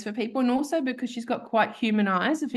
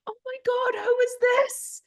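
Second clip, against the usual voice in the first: much higher, delighted squeal at a puppy; gushing clients. Retold or imitated. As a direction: coo over the surprise puppy. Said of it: "they were like".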